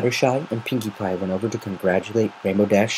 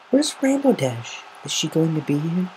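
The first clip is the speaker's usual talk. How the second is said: lightly voiced timid pony, high; Fluttershy's voice; 8 semitones higher than usual. Fluttershy asking where Rainbow Dash is, small high voice.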